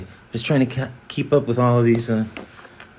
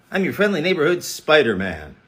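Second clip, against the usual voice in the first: ordinary talk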